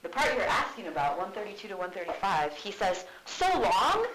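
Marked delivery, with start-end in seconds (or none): 3.2-4.1 much higher, louder mock surprise; sarcastic. Hamlet mocking Ophelia about mourning time, sarcastic. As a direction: feign astonishment. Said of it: "He's still being sarcastic".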